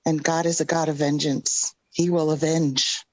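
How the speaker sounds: ordinary delivery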